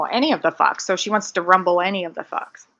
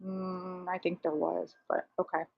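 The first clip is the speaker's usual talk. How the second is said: much softer, doubtful murmur; quiet aside; 12 dB quieter than usual. Real, not acted. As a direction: mutter it under your breath.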